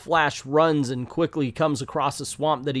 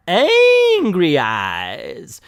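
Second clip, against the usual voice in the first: ANGRY stretched out high and loud, EYES falling away; loud, high, elongated "angry", sinking on "eyes"; hammed-up emphasis; 3 semitones higher, 6 dB louder, much slower than usual. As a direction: draw out "angry" in a loud mock wail, then drop on "eyes". Mock emphasis on villain's glare.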